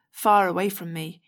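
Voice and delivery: usual speaking voice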